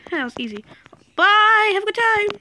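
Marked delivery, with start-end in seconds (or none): 1.2-2.3 high, sing-song farewell taunt; cheeky mocking goodbye; taunting bye. Real, not acted. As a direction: gloat as you wave them off. Taunting opponent after knocking off.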